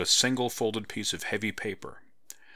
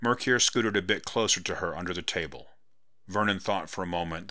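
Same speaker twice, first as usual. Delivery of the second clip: plain reading voice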